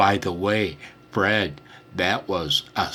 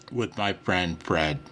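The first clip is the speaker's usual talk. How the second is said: ordinary delivery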